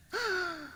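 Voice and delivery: sharp, high intake of breath; courtroom gasp. Acted. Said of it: "There are gasps in the courtroom".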